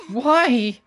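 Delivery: high, strained near-crying questions; tearful pleading. Acted. Sarasa pleading with her old rival, close to tears. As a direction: ask why while your voice cracks with tears.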